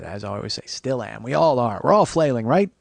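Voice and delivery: normal talking voice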